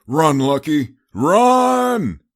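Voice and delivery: high, loud desperate shout; crying out. Acted. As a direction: yell at her to flee. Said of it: "Wester cried".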